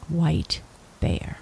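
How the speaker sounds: ordinary delivery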